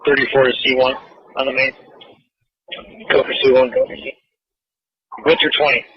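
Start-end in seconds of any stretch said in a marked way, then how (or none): none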